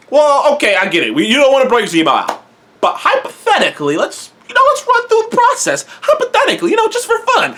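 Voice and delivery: high, sly coaxing voice with a laugh; teasing high wheedling tone; mock coaxing. Bucky coaxing about Zemo.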